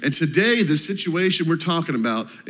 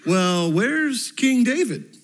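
put-on higher, slow childlike question; four-year-old daughter; 7 semitones higher, much slower than usual. Pastor quoting his daughter asking about King David, higher and slow. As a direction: draw it out like a curious toddler. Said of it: "She said".

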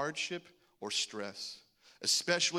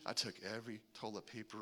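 normal talking voice

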